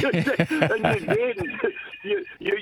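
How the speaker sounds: high-pitched laughter breaking up the words; laughing at the memory while telling it to the host; laughing through it. Real, not acted. Laughing aloud, recounting a teammate's plea to the host.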